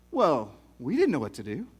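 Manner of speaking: ordinary delivery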